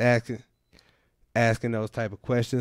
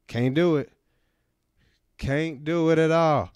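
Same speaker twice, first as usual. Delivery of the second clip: ordinary talk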